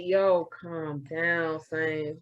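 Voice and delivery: low, soft talk-down; self-calming. Retold or imitated. Host telling herself to chill when she feels yelled at, calming. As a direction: imitate settling yourself, voice dropped. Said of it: "So I have to be like".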